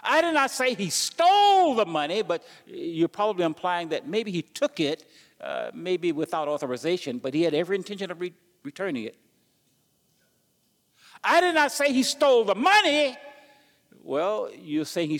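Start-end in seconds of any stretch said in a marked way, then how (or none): 0.0-2.2 "stole" stretched, high and loud; stress on stole. Shown as a demonstration. Preacher showing the congregation stress on "stole". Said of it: "You see how you can say different things". 11.2-13.2 "money" hit high and loud, falling; stress on money. Shown as a demonstration. Preacher demonstrating to the church stress on "money". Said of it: "You see how you can say different things".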